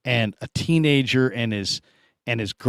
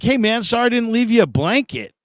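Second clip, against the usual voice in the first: high, jokey call-out; mock friendly; 10 semitones higher than usual. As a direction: tease the stranger to make him sit up. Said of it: "I'm like".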